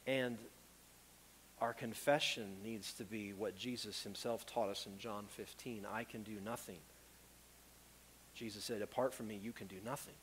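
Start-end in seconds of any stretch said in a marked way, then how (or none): 1.6-10.1 hushed solemn. Acted. Solemn quoting of Jesus.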